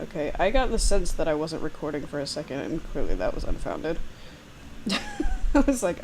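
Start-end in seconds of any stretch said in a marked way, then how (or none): 4.6-5.5 sudden squeaky laugh; high burst of laughter. Real, not acted. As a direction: giggle up high. Embarrassed about recording.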